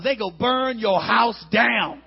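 usual speaking voice

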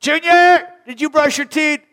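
put-on high, loud parent calling to a child; loud high mom checking on the kid; nagging parent. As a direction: call up the stairs at your kid. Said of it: "toothbrush brusher".

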